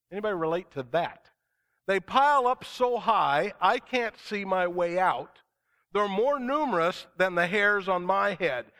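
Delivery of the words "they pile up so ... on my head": slow, louder, higher fervent plea; preacher belting out a psalm of distress; impassioned scripture reading. Acted. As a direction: cry the psalm out to the congregation.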